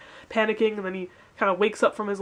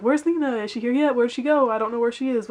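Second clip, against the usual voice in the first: higher-pitched worried searching, lightly mocked; mock-frantic string of questions, pitched up; frantic questioning; 3 semitones higher than usual. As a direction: imitate someone running around looking for a friend. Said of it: "Fabian's kinda running around like".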